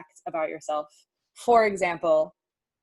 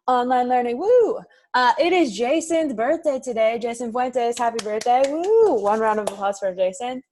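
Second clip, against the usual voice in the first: loud, excited birthday shout-out with high whoops; celebratory cheer; 5 semitones higher, 7 dB louder than usual. Real, not acted. Teacher hyping the online class for Jason's birthday, excited cheering. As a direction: cheer them on loudly, pitch leaping on each woo.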